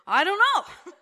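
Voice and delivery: loud laughing reply, pitch up. Real, not acted. Answering the odd cancer question, bursting into laughter. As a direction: laugh it out, amused and helpless.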